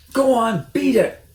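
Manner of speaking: high, loud squeaky shriek; shrieking Rat. A narrator's reading. Rat shrieks at Mister Fox to leave. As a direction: screech like an outraged rat. Rat shouting them away.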